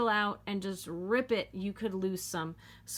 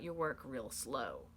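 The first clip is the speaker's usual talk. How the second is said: slow, low and softer; easing slowly down in pitch; drawn-out slow; 8 semitones lower, 9 dB quieter than usual. Shown as a demonstration. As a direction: draw it out, dropping low and quiet. Illustrates backing out slowly.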